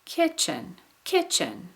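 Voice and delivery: "kitchen" high on the first syllable, falling away; isolated "kitchen" with a clear fall; pitch change on KI. Shown as a demonstration. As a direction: punch the "ki" of "kitchen", drop on the rest. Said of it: "my pitch changed on the ki".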